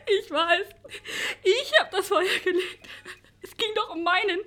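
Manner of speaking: sob-laugh, broken; tearful confession. Acted. Charlotte admitting the fire to the producer, sobbing. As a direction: confess it while crying.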